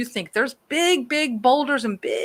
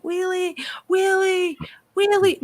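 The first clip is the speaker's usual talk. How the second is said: mock yelling; 9 semitones higher than usual. Owner calling her missing dog in the rain, re-enacted high-pitched yell. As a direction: imitate calling out for a lost dog. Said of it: "I start yelling his name".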